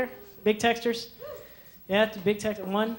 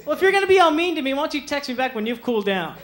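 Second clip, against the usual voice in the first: put-on high, fast, loud offended girl; huffy girlfriend; 6 semitones higher, 4 dB louder than usual. Girlfriend telling her boyfriend off by text, fast and huffy. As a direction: storm off in a huff as the girlfriend. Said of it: "the girl".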